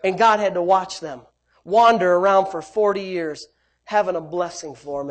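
ordinary delivery

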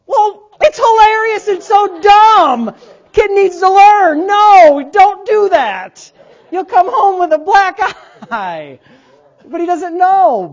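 high, loud and slow through real laughter; laughing hard. Real, not acted. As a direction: crack up while you say it.

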